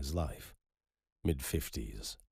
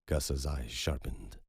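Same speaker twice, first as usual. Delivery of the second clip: ordinary delivery, nothing marked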